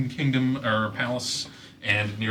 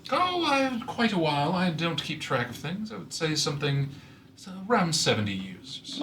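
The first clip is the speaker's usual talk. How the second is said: lightly higher heron voice; 5 semitones higher, 4 dB quieter than usual. Acted.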